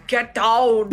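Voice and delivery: put-on a garbled Irish-Austrian accent, laughing through it; mock accent gone wrong; mixed Irish-Austrian accent. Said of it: "I just kept saying".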